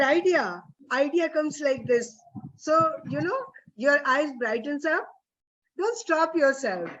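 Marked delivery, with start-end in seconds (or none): none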